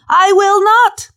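a cocky Peter refusing to believe it; Peter's boast. Acted. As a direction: insist stubbornly as proud Peter. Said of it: "Read my lips".

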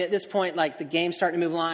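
ordinary talk, nothing marked

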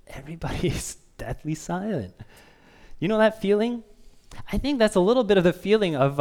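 normal talking voice

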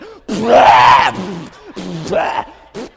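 loud exaggerated gagging splutter; hammed-up spit-out of something vile; disgusted spitting. Kids spitting out seaweed in the car, disgusted.

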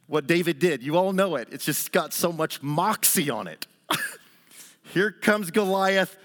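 usual speaking voice

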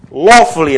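"lawfully" punched loud and high; stress on lawfully. Shown as a demonstration. Lecturer quoting the clause, "lawfully" hammered home. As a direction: hit the first syllable of "lawfully" hard. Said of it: "I want to emphasize the word lawfully".

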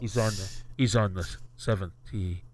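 hushed, low play-by-play murmur; golf commentator. Acted. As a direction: whisper it like a golf announcer on TV. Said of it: "hushed tones of the announcers".

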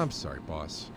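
low, soft sheepish apology; meek apology. Acted. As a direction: mumble it sheepishly. Apologizing meekly to boss.

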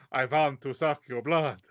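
put-on stage vampire voice; haunted-house vampire. Festival vampire popping out at visitors, put-on vampire voice. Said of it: "a vampire who pops out and goes".

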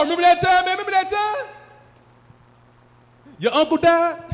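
high, loud, gleeful "Remember that time?"; excited reminiscing. Retold or imitated. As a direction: hype it up like a nostalgic buddy. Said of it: "Their favorite phrase is, "Remember that time".

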